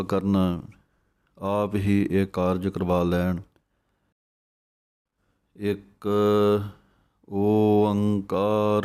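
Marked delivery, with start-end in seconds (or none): 5.6-8.8 ਇੱਕ, a gap, then a long slow ਓੰਕਾਰ; correct pronunciation. Shown as a demonstration. Teaching the sangat to say ਇੱਕ ਓੰਕਾਰ, slow and spaced. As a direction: split ਇੱਕ from ਓੰਕਾਰ and stretch ਓੰਕਾਰ. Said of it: "ਸਹੀ ਬੋਲਣ ਦਾ ਤਰੀਕਾ ਹੈ".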